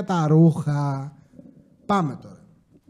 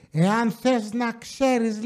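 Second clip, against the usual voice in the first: mimicked complainer, slow and softer; crazy local woman; 3 semitones higher, 5 dB quieter, much slower than usual. Crazy local schools the parking attendant, mock-haughty voice. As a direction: put on the crazy resident's voice, drawing it out. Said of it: "έρχεται μία τρελή εκεί πέρα".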